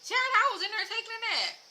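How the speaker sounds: a mother who just woke from a nap; mama unbothered. Retold or imitated. Mama tells her daughter she was napping, lightly voiced and dismissive. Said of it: "Just like".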